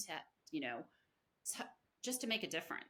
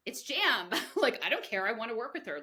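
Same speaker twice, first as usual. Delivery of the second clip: laughing disbelief; 3 semitones higher, 6 dB louder than usual. Retold or imitated. Retelling her own reaction, laughing.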